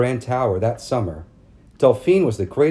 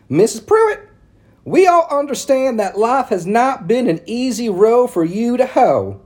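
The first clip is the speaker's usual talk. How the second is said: high, loud prissy society matron; prim town lady; 11 semitones higher, 5 dB louder than usual. A narrator's reading. Narrator voicing Missus Cady calling on Mama, high and prim. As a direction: lift it up into a fussy church lady. Ladies voiced in read story.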